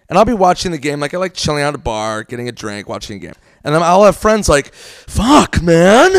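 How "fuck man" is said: higher, mock-frustrated groan to a buddy; exasperated friend. Friend griping to his buddy at the bar, mock exasperation. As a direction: imitate a friend whining in exasperation.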